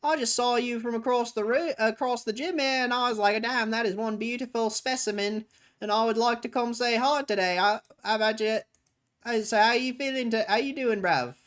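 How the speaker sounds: high, loud London lad chat-up; British accent. Acted. Approaching the gym baddie, hammed-up British accent. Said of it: "catfish someone with that accent".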